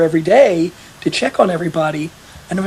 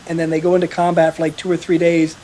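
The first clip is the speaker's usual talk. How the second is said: ordinary talk, nothing marked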